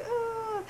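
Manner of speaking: high drawn-out "oh"; imitated yearning cry. Fan imitating Sherlock reaching for John, yearning cry. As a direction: let out a long, high mock moan.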